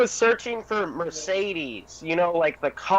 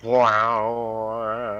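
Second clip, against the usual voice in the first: phaser effect; 7 semitones lower than usual. Real, not acted. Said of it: "Wait, was that actually".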